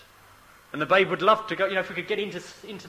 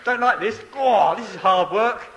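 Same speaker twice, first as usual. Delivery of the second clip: lightly voiced grumbling baby; mock protest of a baby being weaned; 3 semitones higher, 5 dB louder than usual. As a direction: complain on a baby's behalf, a bit louder. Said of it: "it's probably going".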